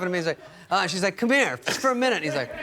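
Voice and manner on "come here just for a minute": mock coaxing. Woman coaxing bachelor aside in story.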